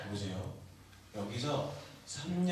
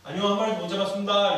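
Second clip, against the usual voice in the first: disciples shouting back; 7 semitones higher, 11 dB louder than usual. Acted. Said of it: "이렇게 대답을 했어요".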